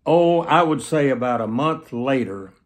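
ordinary talk, nothing marked

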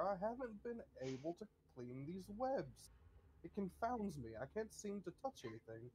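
a courteous butler asking a favour; ghost butler. Acted.

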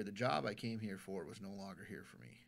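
soft, low, breathy admission; hushed confession. Retold or imitated. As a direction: let it drop to almost nothing. Stranger confessing his despair.

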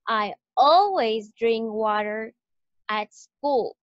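teacher stressing always, drink, water, school for the class. Shown as a demonstration. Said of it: "las que están en mayúscula es que se pronuncian más fuerte".

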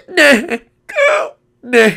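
short, loud, high cries of no; hammed-up horror; mock despair. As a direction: yelp each no separately. Dreads another character's arrival.

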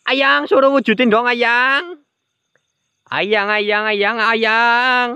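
mock cooing. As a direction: tease the ghost sweetly. Cooing at the ghost. (memanggil manja)

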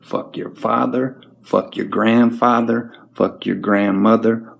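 normal talking voice

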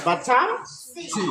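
বাছাংশি drawn out very slowly, ছ clearly sounded; slow careful pronunciation. Shown as a demonstration. Teacher models বাছাংশি slowly for students to copy, drawn out. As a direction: stretch বাছাংশি syllable by syllable. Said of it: "উচ্চারণ হবে বাছাংশি".

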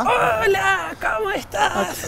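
high, squeaky cartoon sausage; sausage puppet voice. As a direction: play the animated sausage, chirpy and high. (voz de salchicha animada)

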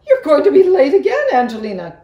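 high, loud and hurried cry; cried out. A narrator's reading. Mother cries out that Angelina will be late, loud and fast. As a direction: scold her from across the house, fast. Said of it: "cried Missus Mousling".

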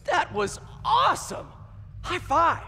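high-pitched, thrilled exclamation; elated cheer. Acted. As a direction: celebrate a win with your partner, bursting with excitement. Hero celebrates after winning.

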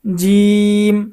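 loud recitation. Shown as a demonstration. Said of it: "সবাই জোরে জোরে উচ্চারণ করবেন।".

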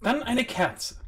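loud high offer; high hostess voice. Acted. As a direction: suggest it brightly. Host offers candle instead.

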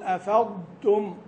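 "أفضتم" slow, ض kept distinct from the light ت; clear dad before ta. Shown as a demonstration. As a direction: keep ض and ت apart in "أفضتم". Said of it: "انتبه أن تميز الضاد من التاء". (تمييز الضاد من التاء)

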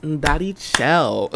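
high-pitched, playful zesty delivery. Shown as a demonstration. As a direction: put on a zesty voice on request. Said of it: "in a zesty voice".

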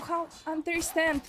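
normal talking voice

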